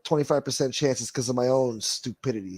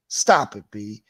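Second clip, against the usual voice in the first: louder, emphatic "Stop it"; louder repeat; 3 semitones lower, 6 dB louder, slower than usual. Real, not acted. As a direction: punch "Stop it" harder the second time. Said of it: "repeats stop it louder".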